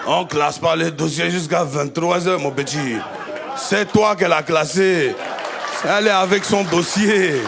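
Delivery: low mocking voice, a laugh in it; mocking older man. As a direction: sneer it down at him like a grown man scolding a kid. Mocking man's voice in story.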